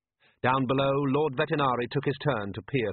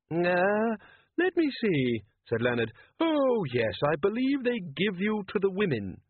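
mild, higher, hesitant inventor; a lightly raised, polite, unsure voice; Leonard's higher voice; 8 semitones higher than usual. A narrator's reading. Leonard answers brightly.

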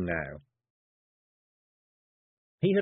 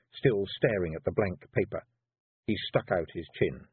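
usual speaking voice

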